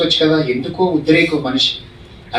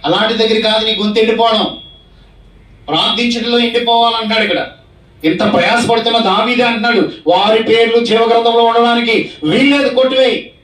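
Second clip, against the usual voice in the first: raised, higher preaching voice, pushing hard; fervent preaching; 7 semitones higher than usual. Acted. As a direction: preach it out with rising fervour. Preacher raises voice on David's prayer. (ఉత్సాహంగా గట్టిగా ప్రసంగం)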